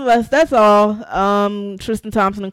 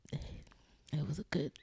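whispering; 6 semitones lower, 14 dB quieter, slower than usual. Real, not acted. Quiet aside about an era.

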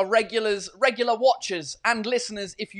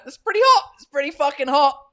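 exaggerated heat complaint; 7 semitones higher, 7 dB louder than usual. Real, not acted.

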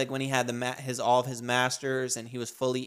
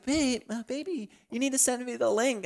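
high-pitched, softer pleading mother; much higher, wheedling mom voice; imitated mom; 10 semitones higher, 5 dB quieter than usual. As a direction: play your mom asking sweetly for something. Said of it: "My mom always be asking me".

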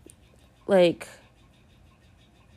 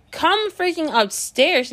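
exasperated call; 8 semitones higher, slower than usual. Retold or imitated. Calling friend upstairs.

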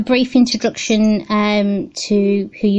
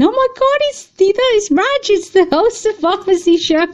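much higher, louder gushing fan voice; excited fan recognising Raj; 12 semitones higher, 4 dB louder than usual. Retold or imitated. Host imitating fans who stopped him at the show, starstruck and much higher. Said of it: "the amount of times I got stopped saying".

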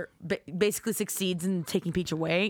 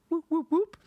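mock siren whoops; 9 semitones higher than usual. Sound effects in read-in.

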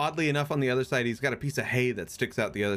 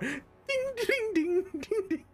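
tiny high dinging plucks; mini harp imitation. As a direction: tinkle like a toy harp with your voice. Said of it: "I got my mini harp".

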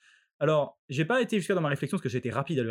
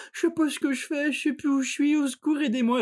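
much higher quavering mock old woman pleading; lost old woman; 10 semitones higher than usual. Imitating the old woman begging for help, high mock plea. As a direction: plead like a lost, confused granny. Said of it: "la vraie vieille dehors qui est là".

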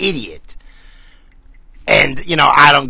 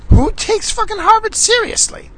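squeaky high mock voice, much higher; mocking echo. Mocking his own question in a squeaky falsetto. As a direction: parrot the question in a silly falsetto.

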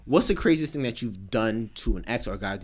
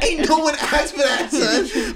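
mock retort; 13 semitones higher, 5 dB louder, slower than usual. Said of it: "I'm like".

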